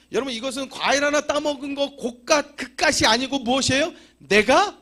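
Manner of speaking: high, loud fervent sermon; full-force preaching. Acted. Pastor warns the congregation about ownership, loud preaching. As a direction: thunder the warning at them.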